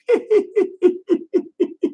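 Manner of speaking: squeaky high laugh; real tickled laugh; high giggle. Real, not acted. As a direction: giggle high up at your own joke. Laughs at own joke.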